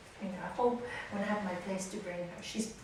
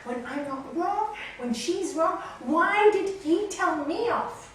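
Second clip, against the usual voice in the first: aggrieved, rising to loud and high; retold indignation, slowing on the final question; angry protest; 7 semitones higher, 7 dB louder, slower than usual. [name] protests to the secretary about being scolded, angry. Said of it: "I'm so angry".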